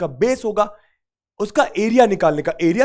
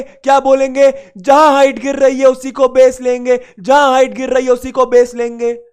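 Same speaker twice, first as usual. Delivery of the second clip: loud, raised-pitch rallying chant; loud shouted recitation; 3 semitones higher, 5 dB louder than usual. Shown as a demonstration. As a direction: shout it out for the class to repeat. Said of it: "तेज चिल्ला के बोलेंगे".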